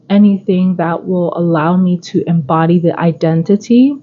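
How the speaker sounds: ordinary talk, nothing marked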